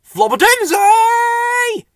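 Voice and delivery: very high, loud, long-held squeaky shout; piercing drawn-out silly call. A narrator's reading. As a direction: stretch the last syllable in a holler. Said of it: "called Bill and Ben".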